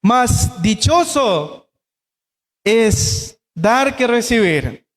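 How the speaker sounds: very slow, each word laid out; preacher reading the verse aloud to the congregation, slowly; slow scripture reading. Shown as a demonstration. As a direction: read the verse slowly and solemnly. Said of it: "Escuche este pasaje".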